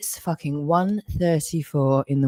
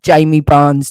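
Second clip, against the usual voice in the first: shout; 9 dB louder, slower than usual. Real, not acted. Shouting a name in rant.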